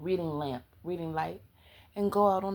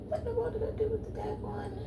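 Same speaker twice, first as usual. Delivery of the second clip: muttered whisper; 6 dB quieter than usual. Real, not acted. Searching for lost review.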